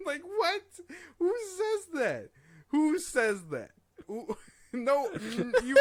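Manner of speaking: high, loud laughing disbelief. Real, not acted. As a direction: squeal it out through laughter.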